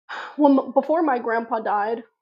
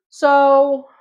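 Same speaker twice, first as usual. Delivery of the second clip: ordinary talk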